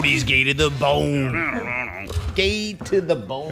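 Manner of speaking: slow, drawn-out punchline; slow drawl. Shown as a demonstration. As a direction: stretch out each word. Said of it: "drawn-out punchline".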